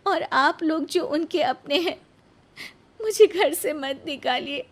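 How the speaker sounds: high, pleading voice near tears; crying plea. Acted. Nisha begging her in-laws, tearful plea. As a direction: beg them not to send you away. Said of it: "निशा रोने लगती है".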